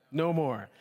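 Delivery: normal talking voice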